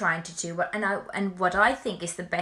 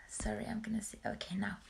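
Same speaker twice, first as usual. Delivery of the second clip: soft unvoiced whisper aside; 11 dB quieter, faster than usual. Real, not acted. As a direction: whisper it quickly to yourself. Quick aside while checking something.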